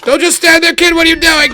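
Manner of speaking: high, loud frustrated yell; yelling at screen. Real, not acted. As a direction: shout at the character to move.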